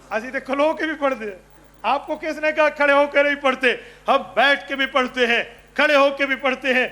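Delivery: retold protest in a loud preaching voice; indignant retort. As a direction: hit back at a false charge, voice high. Accused cleric retorts in retold dispute.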